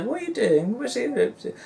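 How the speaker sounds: put-on high, curious child voice; piping up like a little girl; kids' voice. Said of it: "And they're".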